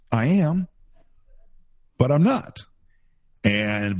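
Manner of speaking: ordinary talk, nothing marked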